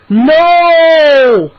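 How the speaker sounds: high, loud drawn-out scream; frantic cry. A narrator's reading. Boy screaming as he falls into the nest. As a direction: scream it as you topple. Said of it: "I heard a frantic cry".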